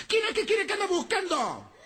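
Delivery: high, furious wife shouting from the balcony; woman yelling from the balcony at the drunk; angry wife yelling. Retold or imitated. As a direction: screech down at the drunk. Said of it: "Lo grita".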